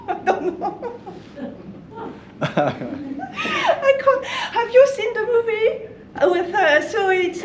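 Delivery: high-pitched giggles through the words; can barely get it out for laughing. Real, not acted. Recalling the Quebec cop movie, laughing high.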